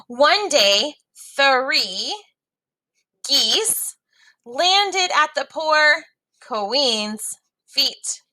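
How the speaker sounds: put-on lively read-aloud for young learners; story reading. A narrator's reading. Teacher reading a story to students, lively read-aloud.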